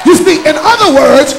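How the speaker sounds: loud, rising preacher's call over music; full-force preaching. Acted. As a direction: drive it home to the congregation. Preaching builds again to shouting.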